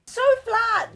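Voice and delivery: high dismayed squeal; dismayed cry. Real, not acted. Cake disappointment.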